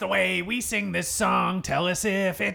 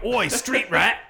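mock cockney; 4 semitones higher than usual. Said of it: "Are people gonna be like".

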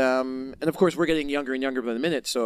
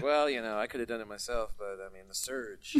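much softer, lower deadpan; deadpan quiet excuse; 6 semitones lower, 9 dB quieter than usual. Retold or imitated. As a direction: shrug it off under the breath. Dry excuse to dying friend.

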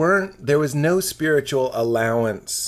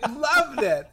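laughing teasing; 9 semitones higher than usual. Real, not acted. Laughing self-mockery.

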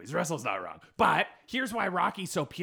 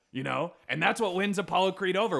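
usual speaking voice

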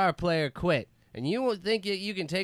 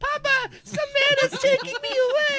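put-on high child voice; 4 dB louder than usual. Kid voice crying for father.

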